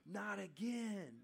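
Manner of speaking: sharp gasp, then slow drawn-out dismay. Acted. Brothers finding the money in their sacks, gasping in dismay. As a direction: gasp and groan it out, pitch up.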